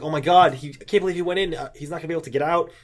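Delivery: hurried, mildly panicked retelling; freaking out. Retold or imitated. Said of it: "people start freaking out".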